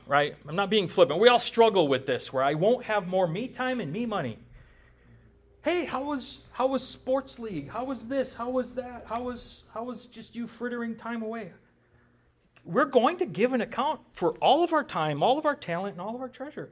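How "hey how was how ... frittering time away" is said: lightly voiced God quizzing, higher and softer; higher, softer mock questioning. God on Judgment Day quizzing a believer about wasted time, higher, softer mock questioning. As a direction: ask it high and soft, teasing.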